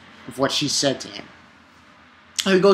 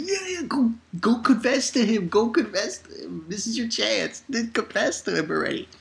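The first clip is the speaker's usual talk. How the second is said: higher, softer egging-on voice; mock urging friends; 7 semitones higher, 5 dB quieter than usual. Her friends at school push her to confess, urging. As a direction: imitate eager friends pushing her, in a high voice.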